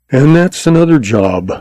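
understated triumph, pitch lifted; exulting. A narrator's reading. Gunman after downing both opponents, mildly exultant. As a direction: crow a little over a finished job. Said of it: "Frayne exulted".

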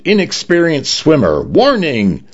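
robot lifeguard sounding a mock alarm; shouted warning. A narrator's reading. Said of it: "it shouted".

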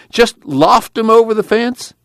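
high, slow pleading; high pleading request to toss them. A narrator's reading. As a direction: keep begging, pitched high.

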